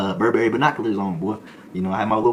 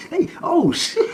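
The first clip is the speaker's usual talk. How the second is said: high, loud, laughing oh shit; cracking up impressed; 6 dB louder, much slower than usual. Retold or imitated. Inmate laughs at his burnout story, high and loud.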